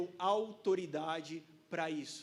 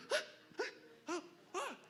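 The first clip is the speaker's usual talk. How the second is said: excited gasps; 11 semitones higher, 5 dB quieter than usual. Retold or imitated. Fishing triumph, excited gasps.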